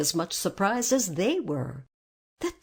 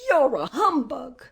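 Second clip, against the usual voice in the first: higher, louder, hurt outburst; grieved accusation; 6 semitones higher, 6 dB louder than usual. A narrator's reading. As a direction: accuse loudly, as if wronged. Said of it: "in a grieved tone".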